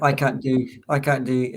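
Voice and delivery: ordinary delivery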